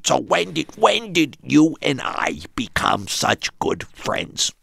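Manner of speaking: high, slow squawky parrot voice; Iago puppet voice. Retold or imitated. Father as Iago puppet asking son about friendship. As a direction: coax gently as the parrot puppet. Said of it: "talk to him as Iago".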